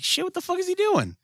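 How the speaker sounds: very high-pitched mock disbelief; acted bewilderment. Podcaster relives watching the scared hero, in high-pitched disbelief. Acting out baffled reaction to show.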